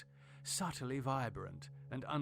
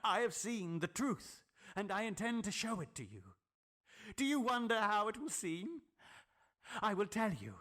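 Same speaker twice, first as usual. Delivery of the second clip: higher, intense voice of a crazed inventor; eager mad scientist; 6 semitones higher than usual. Acted. Tillinghast boasting to his friend, feverish and intense. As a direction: reveal your secret with feverish pride.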